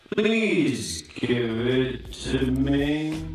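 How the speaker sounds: very slow, stretched-out plea; drawn-out request. Acted. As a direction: drag every word right out.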